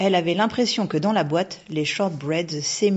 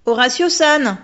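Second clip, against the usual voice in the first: louder, insistent calling of the name; 7 semitones higher, 10 dB louder than usual. A narrator's reading. Girl insists, calling the ghost again, loud and high. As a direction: call the name again, pressing harder. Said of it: "insista la petite fille".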